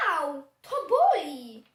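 loud, drawn-out wail of hurt; acted pain cry. Hedgehog with sore tooth, loud slow hurt wail. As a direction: wail slowly and loudly, play-acting pain.

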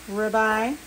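ordinary delivery

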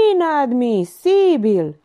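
sarcastic parroting of an indignant notary. A narrator's reading. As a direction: draw out INADMISIBIL with sarcasm. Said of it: "Titel observă sarcastic".

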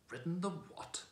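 disdainful horse retort; contempt. A narrator's reading. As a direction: sneer the question in disbelief. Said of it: "retorted the horse with extreme contempt".

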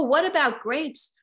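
plain reading voice

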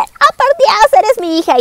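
hammed-up motherly shock, starting very high and coming down; asombro sobreactuado. Madre reconoce a su hija. (exclamación aguda de sorpresa fingida)

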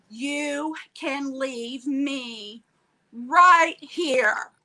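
very slow, drawn-out order; firm angry command. Retold or imitated. Wheelchair user ordering the pusher to stop, slow and firm. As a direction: stretch each word, then hit RIGHT HERE loud. Confronting the woman pushing her.

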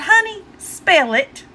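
drawn-out high "Honey, spell it"; slow high request. Retold or imitated. Wife asks husband to spell it, slow and high. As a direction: ask slowly, pitch up. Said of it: "I said".

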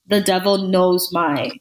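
ordinary delivery

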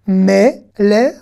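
"Melech" slowly as me, le, then a separate ch; syllable by syllable. Shown as a demonstration. Teacher decoding the Hebrew word for king, syllable by syllable. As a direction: sound out each piece of "Melech". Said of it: "on va faire consonne plus voyelle".

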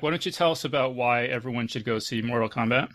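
normal talking voice

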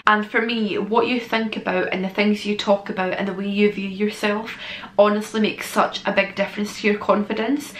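ordinary talk, nothing marked

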